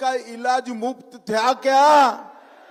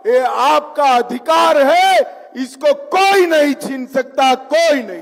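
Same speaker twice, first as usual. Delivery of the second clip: shouted rally cry; 6 semitones higher, 8 dB louder than usual. Real, not acted. Rally climax to crowd.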